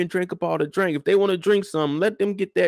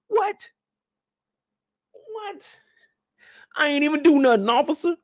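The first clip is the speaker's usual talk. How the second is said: squeaky high protesting innocence; mock panicked, high; hammed-up high innocent protest to the officer; 10 semitones higher than usual. Playing arrested guy protesting.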